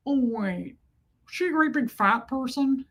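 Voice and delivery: put-on creepy film villain voice; Buffalo Bill. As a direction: imitate a creepy movie killer. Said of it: "when he's Buffalo Bill and he goes".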